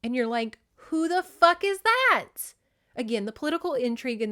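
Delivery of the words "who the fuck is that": high, loud, drawn-out disbelief; hammed-up baffled outcry; exasperated bafflement. Reader lost in the political intrigue, mock exasperation. Acts out confused reader's reaction.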